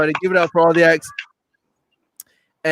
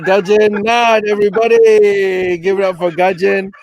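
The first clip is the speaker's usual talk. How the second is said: announcer call-out; 4 dB louder than usual. Real, not acted. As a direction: stretch the name and call for applause. Host calls applause for departing act.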